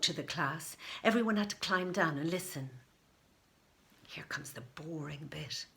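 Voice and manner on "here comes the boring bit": unvoiced bored whisper; whispered thought. A narrator's reading. Morwenna mutters to herself about the boring talk, whispering. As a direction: breathe it out as a bored aside. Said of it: "thought Morwenna".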